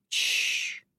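soft, voiceless shush; hushing hiss to silence someone. Acted. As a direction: cut in with a quiet hush. Sister hushes her mid-sentence.

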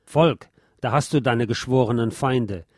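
ordinary delivery, nothing marked

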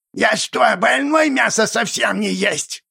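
indignant goblin. A narrator's reading. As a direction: goblin taking offence, creaky. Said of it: "возмутился Кобл".

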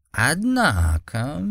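slow drawled character voice; drawn out. Acted. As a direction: stretch the word out lazily. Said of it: "протянул Цзюбэй".

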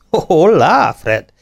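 high, swooping hail; calling out. A narrator's reading. Captain greeting his young owner, calling out. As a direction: sing out a greeting from afar. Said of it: "kiáltotta".

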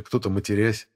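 ordinary delivery

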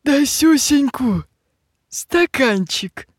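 high, slow sugary coo. Acted. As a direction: coax it sweetly, high and slow. Witch coaxes, belittling the amount. (высоко и сладко, нараспев)

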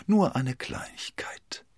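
slow, whispered, sweetly pleasant; overly polite, dangerous charm; amiable. A narrator's reading. Athos amiably demanding the letter. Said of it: "sagte Athos liebenswürdig".

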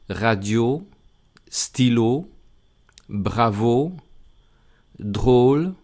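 radio, stylo, bravo, drôle read in French with a closed O; French words with plain O. Shown as a demonstration. Teacher reads plain-O French examples to the class, careful French. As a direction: give each French O its proper sound. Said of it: "Y con una O normal".

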